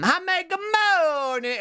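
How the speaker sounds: high, slow sing-song hello; cutesy greeting. Real, not acted. Streamer greets a viewer in chat, high sing-song. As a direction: coo a drawn-out good morning to a friend.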